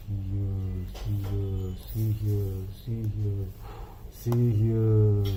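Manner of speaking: low, soft ghostly murmur; haunt him from the dark trees; spooky monster voice. Acted. Forest monsters calling to the scared duckling, low and ghostly.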